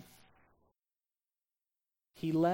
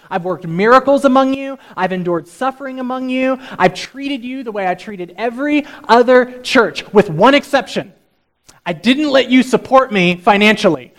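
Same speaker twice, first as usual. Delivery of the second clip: loud, high, indignant sarcasm; sarcastic tirade ending in a mock apology; 7 semitones higher, 20 dB louder than usual. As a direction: protest loudly with heavy irony. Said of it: "Do you hear the sarcasm?".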